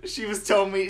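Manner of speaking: ordinary delivery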